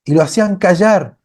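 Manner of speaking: usual speaking voice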